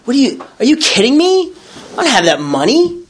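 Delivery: high, incredulous mock indignation; indignant retort. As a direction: act out being affronted by the request. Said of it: "I was, like, so indignant".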